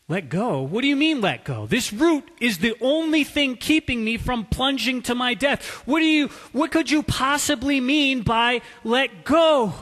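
loud, high frantic protest; panicked disbelief. Acted. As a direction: shout it out in panic. Terrified man argues with God.

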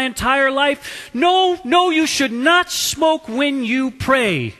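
high, loud indignant scolding; indignant telling-off, slowing on the final verdict; outraged priest. Acted. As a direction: scold in shocked, hammed-up outrage.

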